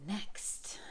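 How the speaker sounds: a soft breathy whisper to herself; whispered next. Real, not acted. Whispering to the camera as she moves to the next question. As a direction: murmur it under her breath as a quick aside.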